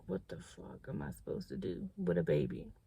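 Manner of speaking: lost and overwhelmed; hushed despair. Retold or imitated. Despair after a positive test.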